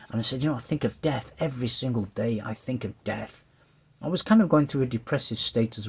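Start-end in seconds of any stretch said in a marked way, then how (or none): 2.4-3.4 quiet, slower repeat. Real, not acted. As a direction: drop the voice and slow down on "I think of death". Repeats the death line, then quieter.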